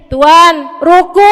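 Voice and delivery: loud call close to the ear. Retold or imitated. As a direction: raise the voice right at his ear. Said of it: "Belum kedengeran juga".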